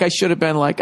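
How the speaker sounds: ordinary delivery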